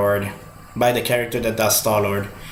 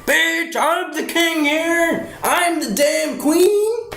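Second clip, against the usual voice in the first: very high, slow, sassy diva voice; sassy T-Rex voice; much slower than usual. Retold or imitated. Voicing the T-Rex boasting in the movie, high sassy diva. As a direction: strut it like a queen claiming her throne. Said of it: "The T-Rex were like".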